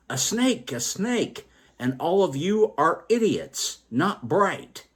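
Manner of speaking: spiteful shout. A narrator's reading. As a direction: spit the insult out. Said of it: "shouted full of spite".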